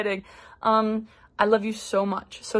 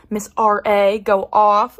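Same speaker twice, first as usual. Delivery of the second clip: louder, punchy hype-up; hype cheer; 6 dB louder, slower than usual. Real, not acted. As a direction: cheer her on like a fan. Hyping friend's new RA job.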